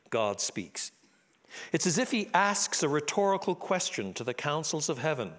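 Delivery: usual speaking voice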